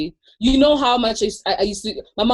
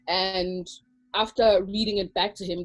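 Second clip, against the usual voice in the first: ordinary talk, nothing marked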